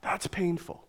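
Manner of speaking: a pained breath of a whisper. Real, not acted. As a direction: let it out barely voiced. Pastor breathing out his pain.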